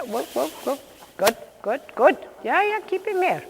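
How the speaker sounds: high excited urging; excited calling. Real, not acted. Coach calling to horse and rider.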